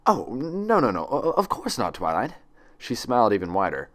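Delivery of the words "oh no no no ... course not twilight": high, hurried, stumbling no no no; mild panicky reassurance; flustered denial. Acted. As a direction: backpedal nervously. Nurse nervously backpedaling.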